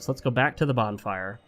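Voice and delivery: usual speaking voice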